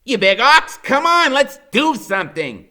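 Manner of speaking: put-on high, loud, pushy boy voice; impatient Freak. A narrator's reading. Freak pestering his friend at breakfast, high and loud. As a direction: hurry him along, high and loud. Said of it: "he's going".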